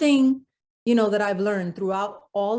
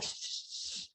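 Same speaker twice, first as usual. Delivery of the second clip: soft voiceless shh; shushing; 18 dB quieter than usual. Retold or imitated. Crew hushing a newcomer outside the theater, voiceless shush. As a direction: shush someone hard to be quiet. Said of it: "They're shushing me".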